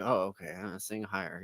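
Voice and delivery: low, soft imitation of an obliging singer; meek compliance. Singer accepting a bad note from the director, meek compliance. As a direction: drop to a small, unsure mumble.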